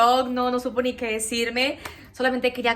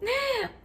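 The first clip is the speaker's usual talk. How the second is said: sharp high gasp of surprise; high startled intake of breath; surprised gasp; 12 semitones higher, 5 dB quieter than usual. Retold or imitated. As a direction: gasp as if seeing the result. Seeing the pregnancy test.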